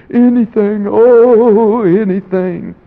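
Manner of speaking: loud, high, crying out anything; desperate promise. Retold or imitated. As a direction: give your whole heart in one word. Lenny answering, desperate promise.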